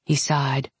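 ordinary delivery, nothing marked

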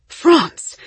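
high, loud call to the dog; yell. A narrator's reading. Mother calling off a crazed dog, yelling. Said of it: "Mama yelled at the crazed dog".

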